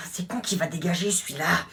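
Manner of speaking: grumbled in a whisper; whispered aside. Acted. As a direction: whisper it behind his back.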